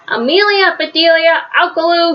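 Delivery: high, scolding call; shouted names. A narrator's reading. Missus Rogers calling the culprits, high scolding shout. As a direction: call each name out high and firmly. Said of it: "shouted Missus Rogers".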